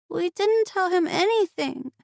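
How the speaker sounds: put-on high child's voice; little girl. A narrator's reading. Aurora assuring her aunt, high child voice. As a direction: insist like a young girl.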